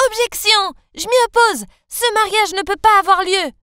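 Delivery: high, loud cry to stop the wedding; shouted objection. Acted. Girl bursting into the ballroom, loud high objection. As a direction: burst in and halt the ceremony. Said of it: "J'ai crié quand je suis entrée".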